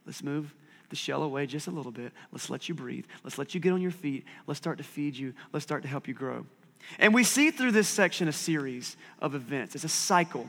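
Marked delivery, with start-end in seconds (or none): 0.0-6.4 soft, tender and reassuring; softer, coaxing words from God; gentle God voice. Acted. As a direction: coax a fragile newborn to breathe. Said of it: "near the end phase of saying".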